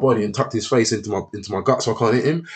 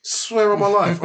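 usual speaking voice

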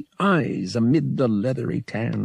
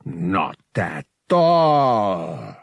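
alien priest voice; 2 semitones lower, 3 dB louder, much slower than usual. A narrator's reading. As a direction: lecture like a smug alien creature. Teroenza answers Han.

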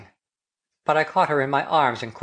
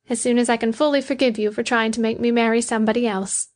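higher-pitched woman's reply; teasing Betty; 7 semitones higher than usual. A narrator's reading. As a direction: playfully answer in the woman's raised voice.

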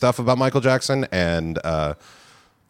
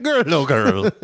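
put-on Irish accent; 6 semitones higher, slower than usual. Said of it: "saying porn titles in an Irish accent".

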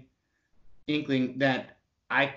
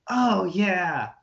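innocent, clueless little oh yeah; playing dumb; 5 semitones higher, 4 dB louder than usual. Dodging the recruiter's question, playing dumb. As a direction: act like you have no idea what she means. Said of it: "Like, trying to play stupid".

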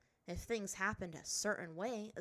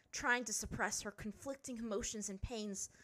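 ordinary delivery, nothing marked

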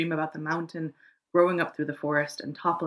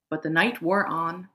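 usual speaking voice

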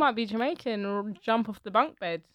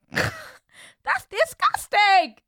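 high, drawn-out revulsion; exaggerated grossed-out verdict; laughing disgust; 13 semitones higher, 3 dB louder, much slower than usual. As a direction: squeal it slowly through amusement. Reaction to dirty-talk insult.

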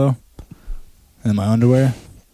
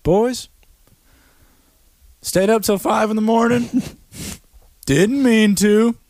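high-pitched mock excuse, slowing at the end; imitating Marco; 8 semitones higher than usual. Friend excusing a missed morning meet-up, mocked in high voice. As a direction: put on a higher voice, dragging out the apology. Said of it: "Marco's like".

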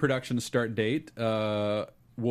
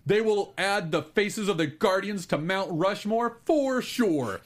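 big booming hype announcer, higher; monster truck ad; 8 semitones higher, 5 dB louder, slower than usual. Acted. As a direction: sell it like a monster truck rally. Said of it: "announcing a monster truck rally".

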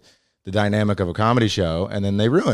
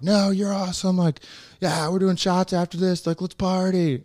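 much higher, bubbly crowd members; excited crowd voice; 12 semitones higher than usual. Retold or imitated. Comic imitates the audience reassuring him after the fight, high and gushing. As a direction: do the crowd gushing over you. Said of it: "And they were all like".

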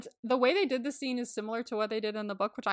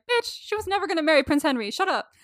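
louder, higher mock rant; a hammed-up exasperated rebuke, louder and higher; mock outraged retort; 6 semitones higher, 6 dB louder than usual. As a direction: snap back with hammed-up outrage. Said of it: "I'm like".

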